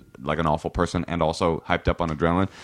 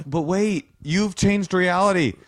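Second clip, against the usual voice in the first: high-pitched, pleading objection; flustered protest; 7 semitones higher than usual. Acted. Reacting to someone's pronoun correction, flustered protest. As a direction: plead for time, pitched well up.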